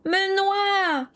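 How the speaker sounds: put-on high, slow child's wail; wailing protest. A narrator's reading. Matt protests at his hair being washed, high child's wail. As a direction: howl like a boy being washed. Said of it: "en hurlant".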